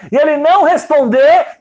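não drawn out high and loud with a rising tune; stress on não. Real, not acted. Preacher stressing that the humiliated man does not answer back. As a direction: lean hard on não, slow and high. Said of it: "stressing the key condition".

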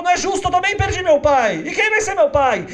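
put-on a jealous orphan girl; second girl, aggrieved. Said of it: "Chegou uma segunda menina e falou". (segunda menina reclamando, aguda)

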